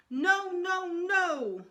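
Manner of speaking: put-on high, loud toddler shout no no no. A narrator's reading. Little brother refusing mother's food, loud high shout. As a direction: scream it like a stubborn little kid. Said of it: "Fudge shouted".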